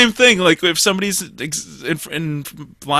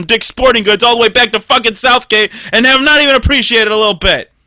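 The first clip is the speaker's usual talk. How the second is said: loud, high building complaint; mock-aggrieved rant; 5 semitones higher, 5 dB louder than usual. Complaining about dad's unappreciated gift, comic aggrieved rant.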